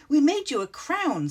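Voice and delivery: high, loud and gleeful. A narrator's reading. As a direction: burst out with delight. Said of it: "said Squiffle with glee".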